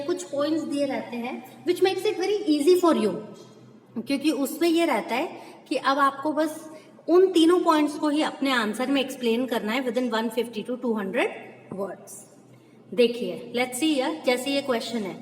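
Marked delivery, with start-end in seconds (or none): none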